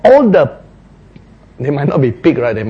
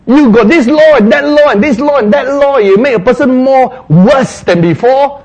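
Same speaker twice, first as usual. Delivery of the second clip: loud, higher, insistent rebuke; scolding; 9 semitones higher, 6 dB louder than usual. Acted. Jesus scolding the Pharisees, loud rebuke. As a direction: scold them hard. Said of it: "Jesus scolded them".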